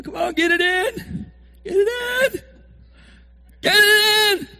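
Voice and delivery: high, loud, slow begging chant; mock desperate pleading. Fan pleading at the screen near the goal line. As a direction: beg the team to score.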